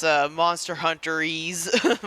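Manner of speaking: ordinary delivery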